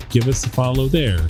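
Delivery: ordinary talk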